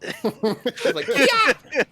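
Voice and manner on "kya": high, loud screech call; shrill shriek. Acted. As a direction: screech it out again.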